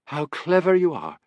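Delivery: admiring exclamation, pitched high; exclaiming. A narrator's reading. Woman praising the policeman's solution, exclaiming. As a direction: gush it with a lifted voice. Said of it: "she exclaimed".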